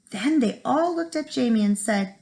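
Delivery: ordinary delivery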